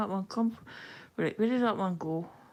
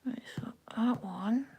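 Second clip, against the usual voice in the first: whisper; 6 dB quieter than usual. Real, not acted. Counting stitches under her breath.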